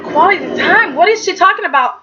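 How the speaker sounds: high-pitched baffled question; incredulous echo. Retold or imitated. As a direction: throw "Quality time" back as a disbelieving question. Repeats the phrase in disbelief.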